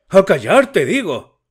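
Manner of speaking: father roaring at his son to be silent; bellowing. A narrator's reading. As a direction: bellow it with full force. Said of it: "bramó Yevgraf Ivanovich".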